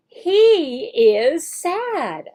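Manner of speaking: slow, clear, stretched model line; slow modeled sentence. Shown as a demonstration. Teacher reads the sentence aloud for the student, slow. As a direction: say it slowly while underlining. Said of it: "while you're saying it".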